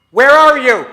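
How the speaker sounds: loud, high shout from above; rescuer's shout. Shown as a demonstration. Rescuer shouting to people buried in the earthquake, loud. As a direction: call down hard through the rubble. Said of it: "There's been an earthquake".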